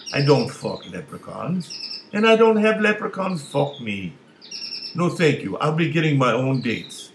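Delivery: loud, rising panicked protest; horrified refusal. Acted. Big guy refuses the leprechaun's offer, loud and appalled. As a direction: push up and out, appalled.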